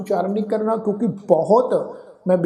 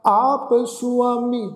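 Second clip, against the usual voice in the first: slow recitation with long held final syllables; measured level-toned sacred reading; scripture recitation; 3 semitones higher, much slower than usual. Acted. Teacher recites Gurbani to the congregation, slow scripture recitation.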